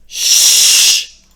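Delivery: loud, forceful shushing hiss; sharp, noisy "shh"; urgent shush. A narrator's reading. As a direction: hush someone screaming. Carl hushes the shrieking Raven.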